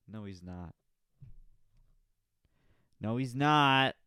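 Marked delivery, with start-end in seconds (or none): 3.0-3.9 much louder, higher contradiction; emphatic loud denial. Acted. Podcaster taking back his own joke about the comic, emphatic shout. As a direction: belt out the denial, leaning on "not".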